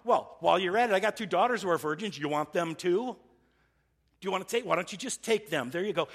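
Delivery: loud, high sarcastic outrage. Retold or imitated. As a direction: throw it back at them, dripping sarcasm. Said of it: "speaking sarcastically".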